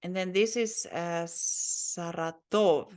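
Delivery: ordinary talk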